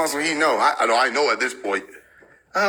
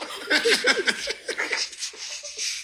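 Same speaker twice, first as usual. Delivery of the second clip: high breathless wheeze of laughter; airy laugh; 6 dB quieter than usual. Real, not acted. Streamer wheezing at a chat line, airy laugh.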